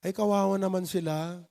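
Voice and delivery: pitying. Retold or imitated. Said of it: "ano sasabihin".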